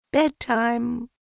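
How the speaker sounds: high singsong bedtime call; Kanga voice. A narrator's reading. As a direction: sing it out like a mother. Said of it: "she said".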